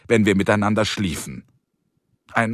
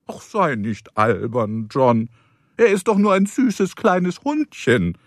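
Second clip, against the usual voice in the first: chiding reassurance; 5 semitones higher than usual. Acted. Carrie soothes John about her dog.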